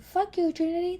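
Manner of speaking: high, softly voiced mock insult; soft teddy bear. Sister imitating brother cursing at her, soft teddy bear voice. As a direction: imitate a harmless softie swearing. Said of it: "he sounds like a really soft teddy bear".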